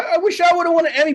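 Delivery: high, fast mock-jealous complaint; comic self-pitying grumble; mock whine. Real, not acted. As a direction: play up the envy, fast and high. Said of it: "but that's fine".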